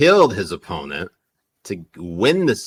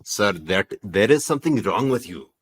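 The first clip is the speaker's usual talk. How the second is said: put-on Punjabi-English accent, saying "sir"; 2 semitones higher, 4 dB louder than usual. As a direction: scold him in a Punjabi accent. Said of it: "when you do your Punjabi accent".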